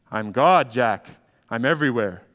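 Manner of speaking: a hint of low, divine authority; lightly voiced God. God introduces himself to Jack, lightly acted in low voice. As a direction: answer low and assured, lightly playing God.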